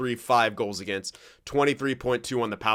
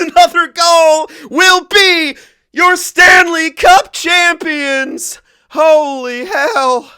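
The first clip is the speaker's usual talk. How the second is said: much higher, loud, slowed announcer call; championship call; 15 dB louder, slower than usual. Acted. Gamer calls his team's Stanley Cup win, booming announcer style.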